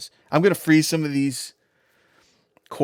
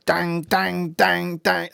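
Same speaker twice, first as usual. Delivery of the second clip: rhythmic imitation of a stomping drop, a bit higher; mock beat; 4 semitones higher, much slower than usual. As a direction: tap out the beat with your voice. Imitating a Big Room House beat.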